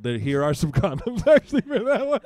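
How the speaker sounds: laughs through the punchline, voice shooting up high; cracking up at his own joke; laughing. Real, not acted. Father joking about handing son condoms, laughing.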